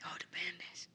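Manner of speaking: whisper. Acted. Echoes in whisper.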